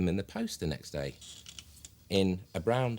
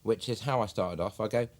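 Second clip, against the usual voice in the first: ordinary talk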